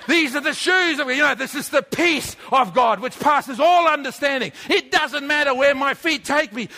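high and loud, pressing on every line; full-force preaching. Acted. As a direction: preach it hard to the crowd.

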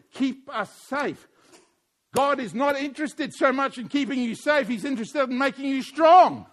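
loud, driving pulpit voice; fiery sermon delivery; preaching at full force. Acted. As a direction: preach it hard to the congregation.

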